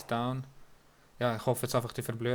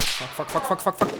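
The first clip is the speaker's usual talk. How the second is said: rising frantic cursing, getting louder; panicked swearing; 5 semitones higher, 7 dB louder than usual. Real, not acted. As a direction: panic as the hits land, louder each time. Panicked swearing under fire.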